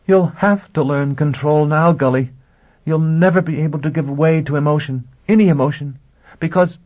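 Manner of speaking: high, insistent, overwrought woman; near hysteria. A narrator's reading. Woman warning Gully he must learn control, near hysteria. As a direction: press each phrase out high and overwrought. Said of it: "began to laugh hysterically".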